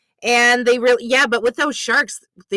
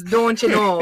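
Midwest accent. Shown as a demonstration. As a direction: show the real Midwest "don't you know". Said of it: "not like".

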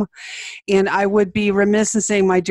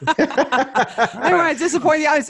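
laughing; 8 semitones higher than usual. Real, not acted. Laughing after the impression.

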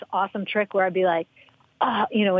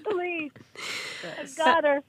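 mock victory; 8 semitones higher, much slower than usual. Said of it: "I would have been like".

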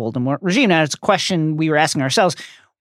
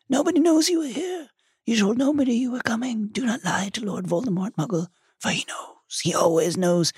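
cold, softer, higher whisper; icy cold; 6 semitones higher, 6 dB quieter than usual. Acted. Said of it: "said the cold voice very quietly".

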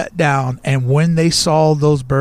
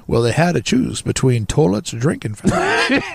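put-on voice, pitch swooping upward; hammed-up storyteller quote with laughter creeping in; Walt Disney. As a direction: play it as a comic impersonation. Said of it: "In that voice".

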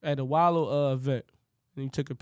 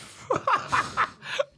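high breathy laugh. Real, not acted. Laugh after the story.

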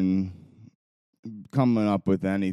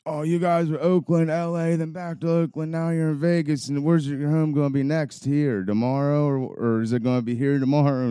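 higher-pitched, taunting retelling of a rival; mocking rival fan; 7 semitones higher, 3 dB louder than usual. Rival fan taunts visiting fans, mocking. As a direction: imitate a heckler needling you, pitched up. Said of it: "one of the dudes was like".

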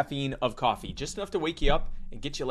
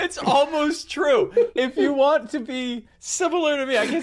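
excited; 13 semitones higher, 5 dB louder than usual. Real, not acted. Excited laughing backpedal.

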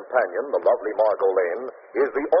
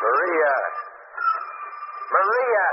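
calling out; 12 semitones higher, much slower than usual. Acted. Husband calling wife from another room.

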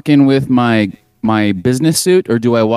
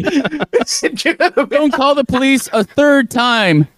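mock plea; 13 semitones higher, much slower than usual. Pleading in pitched-up voice.

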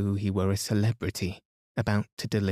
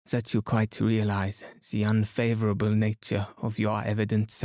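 put-on French-accented detective, pressing; Poirot. Poirot pressing Inglethorpe about Monday afternoon, accented and insistent. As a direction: question him firmly in a French accent.

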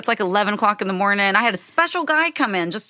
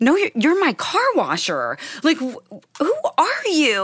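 higher, baffled disbelief; as if asking who on earth this is; incredulous disbelief; 7 semitones higher than usual. Retold or imitated. To the wasted car washer claiming he's a Hell's Angel, incredulous. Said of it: "And I'm sitting here going".

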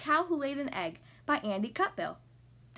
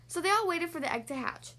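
ordinary delivery

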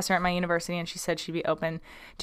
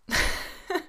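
giggle; 10 semitones higher, 5 dB quieter than usual. Real, not acted. Laughing at an urge to sneeze.

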